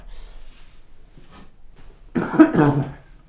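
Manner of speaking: dry hacking cough. Real, not acted. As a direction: cough hard once. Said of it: "I got a cough".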